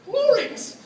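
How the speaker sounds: mock-ecstatic cheer; ecstatic cry. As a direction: burst out with delight, way up high. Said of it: "And I'm like".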